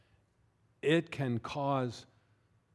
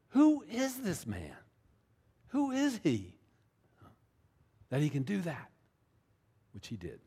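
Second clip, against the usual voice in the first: awed fearful wonder; 5 semitones higher than usual. Retold or imitated. Preacher voicing disciples frightened by Jesus, awed higher voice. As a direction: breathe it out like a shaken fisherman.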